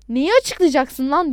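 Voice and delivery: loud, slow, furious challenge; angry shout. A narrator's reading. Adnan exploding at the caller's excuses, shouting angrily. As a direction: shout it back, drawing out the words. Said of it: "diye bağırmaya başladım".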